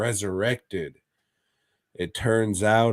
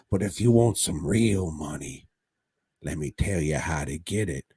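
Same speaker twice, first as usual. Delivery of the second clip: mock doctor, Cockney-style accent; 5 semitones lower than usual. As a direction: sly offer in a comic Cockney-style accent. Doctor voice continues the offer.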